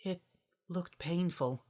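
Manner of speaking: hushed, gentle worry; soft whisper. A narrator's reading. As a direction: say it under your breath. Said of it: "Fluttershy whispered".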